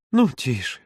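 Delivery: usual speaking voice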